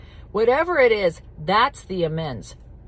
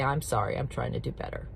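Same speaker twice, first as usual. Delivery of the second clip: soft humble apology; 8 semitones lower, 8 dB quieter, faster than usual. Shown as a demonstration. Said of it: "And then you can say".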